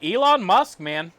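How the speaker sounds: normal talking voice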